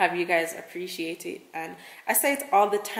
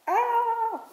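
sudden high shout to test the echo; quick high yell into an empty room. Real, not acted. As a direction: belt out one high note to hear it bounce. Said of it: "hear the echo".

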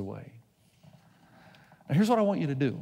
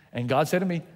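usual speaking voice

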